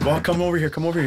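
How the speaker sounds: high, eager call to come over; bursting to tell the news; excited. Retold or imitated.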